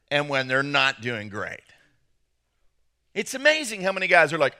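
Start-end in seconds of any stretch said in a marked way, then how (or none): 0.1-1.6 dropped, lower comedown voice; deflated. Acted. Pastor on men watching their team lose, deflated. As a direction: let the voice sink in disappointment. Contrasting losing team with winning.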